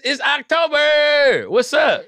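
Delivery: high, slow, gleeful squeal; stretched-out thrilled squeal. Real, not acted. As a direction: drag it out with glee. Excited about scary October topic.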